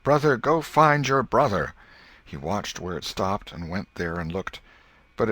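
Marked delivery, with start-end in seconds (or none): 0.0-1.7 put-on high, loud boyish call; loud command. A narrator's reading. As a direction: call it out boldly.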